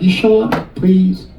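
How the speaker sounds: put-on pleading nail lady, Chinese accent; put-on Chinese accent, coaxing; imitated Chinese accent. Nail lady coaxing client into chair, imitated Chinese accent. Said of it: "That's her accent".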